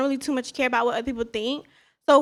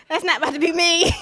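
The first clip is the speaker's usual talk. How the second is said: laughing through the words; 4 semitones higher, faster than usual. Real, not acted. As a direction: giggle it out. Laughing at her own quote.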